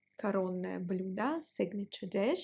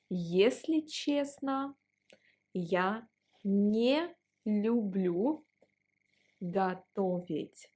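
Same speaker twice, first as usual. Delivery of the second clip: slow teacher drill; slower than usual. Shown as a demonstration. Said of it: "teacher drill pace".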